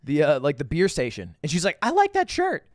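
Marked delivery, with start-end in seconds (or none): 1.8-2.6 imitated woman's high-pitched compliment; admiring stranger. Retelling a woman's compliment in Vegas, mock admiring voice. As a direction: pitch it way up as an approving passer-by.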